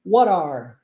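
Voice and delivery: what are said as one word, T turned to R, wharr; what are run together with a flapped R; teacher demo of what are blended with an R; linked what are. Shown as a demonstration. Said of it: "como se fosse uma palavra só".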